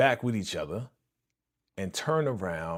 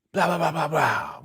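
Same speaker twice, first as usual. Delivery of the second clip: loud rapid-fire gunshot noises; sudden vocal volley of shots; imitated gunfire; 5 semitones higher, 4 dB louder than usual. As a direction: rattle off a burst of mock gunfire.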